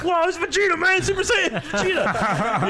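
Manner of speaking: hammed-up anime fan thrill; mock excited fan. Mover excited about Dragon Ball.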